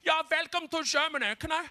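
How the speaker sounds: high-pitched German border official; singsong German-accented English. Retold or imitated. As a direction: imitate a German passport officer, rising on the request. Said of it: "I get there and the guy's like".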